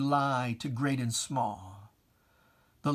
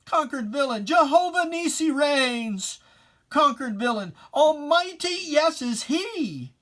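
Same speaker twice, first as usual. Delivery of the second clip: higher and louder, ringing out in triumph; triumphant proclamation; 11 semitones higher, 6 dB louder than usual. Acted. Poet proclaiming Jehovah Nissi reigns, loud and triumphant. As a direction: declare God's victory at full voice. Closing climax of the poem.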